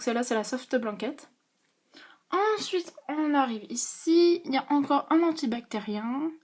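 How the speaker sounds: usual speaking voice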